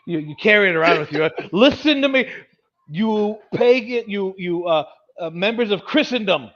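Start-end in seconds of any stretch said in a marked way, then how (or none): 1.5-6.5 loud, high mock harangue; hammed-up street-corner denunciation; soapbox preacher. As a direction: rail at the crowd from a soapbox. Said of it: "you get your soapbox".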